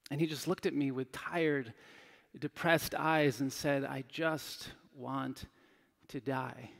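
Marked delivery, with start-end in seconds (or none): 3.8-6.7 weary hopeless murmur. Retold or imitated. Hospice patient, tired, depressed.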